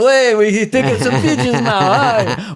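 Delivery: high, loud owner bit; lightly voiced eager restaurateur; restaurant owner. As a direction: play the owner pestering for photos. Acts out pushy restaurant owner.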